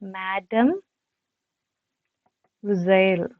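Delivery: മാഡം then a pause, വിസേൽ drawn out as vi-zel; teacher modelling the French word slowly; slow careful pronunciation. Shown as a demonstration. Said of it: "എന്നാണ് actually ഈ ഒരു വേർഡിനെ pronounce ചെയ്യേണ്ടത്".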